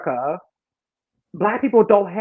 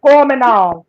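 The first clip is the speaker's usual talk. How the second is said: higher, louder mock Jamaican; fake Jamaican accent; 4 semitones higher, 7 dB louder than usual. Shown as a demonstration. Mimicking for the Jamaican friend, fake Jamaican accent. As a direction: put on a Jamaican accent and call it out. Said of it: "I mimic a fake Jamaican accent".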